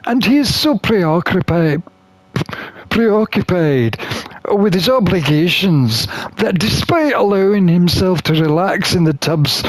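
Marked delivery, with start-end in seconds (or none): none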